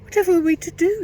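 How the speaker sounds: put-on very high, fast, frightened girl voice; panicked little girl; trembling girl. A narrator's reading. Mary begging for help after Fenella vanishes, trembling.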